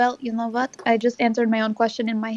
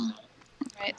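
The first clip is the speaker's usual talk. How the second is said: whisper; 3 semitones lower, 13 dB quieter than usual. Pretend. Sneaking stealthily in the scene.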